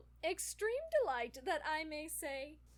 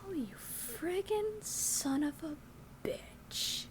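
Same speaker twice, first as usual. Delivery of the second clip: whispered curse; 6 semitones higher, 4 dB louder, slower than usual. Acted. Captain loses the chess game.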